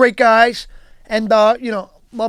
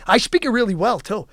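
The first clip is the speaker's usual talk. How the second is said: ordinary delivery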